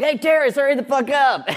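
high, laughing heckler yell; imitating the heckler on set; mock yell. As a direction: shout it at the actor crossing. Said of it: "a guy going like".

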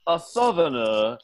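mock-courtly southern accent; southern gentleman accent. Southerner at King Arthur's New England court, courtly southern accent. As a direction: announce yourself as a courtly southerner. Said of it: "a southerner in King Arthur's New England".

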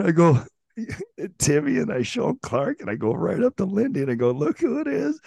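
high, giddy story through laughter; barely holding it together; telling the FaceTime prank, giddy and high; laughing while telling. Real, not acted.

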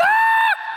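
high terrified scream. Retold or imitated. Jumper screaming in freefall, high and terrified. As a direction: scream your lungs out falling.

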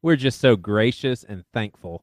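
ordinary delivery

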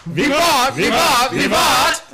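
high, loud hammed-up English cheer; mock English accent shout. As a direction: belt it out like a mock English toastmaster. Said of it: "do it in our best English accent".

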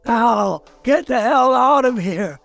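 irate, exasperated warning; angry threat. Acted. Ghoul to visitor after chems or caps, angry threat. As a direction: chase off a pest with threats.

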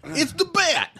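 high mock Brooklyn goon cry; Brooklyn henchman yell. Brooklyn henchman spotting Batman, high yell. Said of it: "give me a Boston accent".

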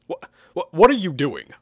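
stammer, then demand an answer loudly. Acted. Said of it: "I demanded".

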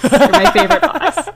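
a fawning henchman, laughing; laughing mock Goggins. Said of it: "Walton Goggins is like".

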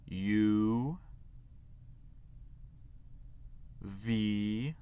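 isolated letters with long vowels and rising ends; teacher modelling the alphabet for repetition; letter-by-letter model. Shown as a demonstration. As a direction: say each English letter alone, lifting at the end. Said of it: "Vous allez répéter après moi pour chaque lettre".

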